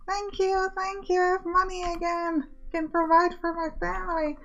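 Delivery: high, overjoyed worker gushing thanks; grateful worker voice. Retold or imitated. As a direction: squeak out thanks like a relieved little worker.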